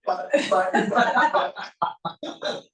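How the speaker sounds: lightly voiced little child repeating himself; imitating a toddler.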